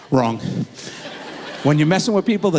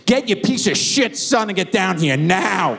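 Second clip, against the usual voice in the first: higher, slow and menacing; angry mother on the phone; slow mock fury; 7 semitones higher than usual. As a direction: spit each word as a furious mom. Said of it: "and goes".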